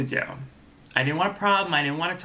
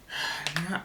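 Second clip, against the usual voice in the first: soft exhaled sigh; 8 dB quieter than usual. Real, not acted. Vlogger hunting for the word, soft sigh. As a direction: let out a quiet sigh.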